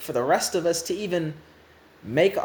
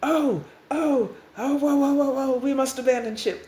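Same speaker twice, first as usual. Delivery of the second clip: alarmed; 7 semitones higher than usual. Acted. The other restarts catch on and flee, alarmed. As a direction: cry out in mock panic. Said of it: "they're like".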